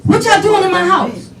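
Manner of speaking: high-pitched mock yell; imitated dad demand. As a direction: play a father calling through a locked door. Father yelling through locked door.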